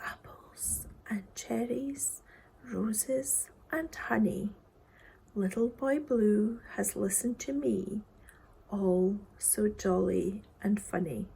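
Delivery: slow, soft whispered ending. A narrator's reading. Reader closing the poem, slow whisper. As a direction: fade the poem out in a hush.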